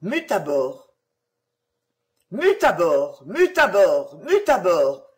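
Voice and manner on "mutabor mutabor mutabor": louder, triumphant shout of the spell; joyful cry. A narrator's reading. The caliph and vizir shout the spell as they fly off. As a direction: cry it out as you take flight. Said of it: "crièrent en s'envolant".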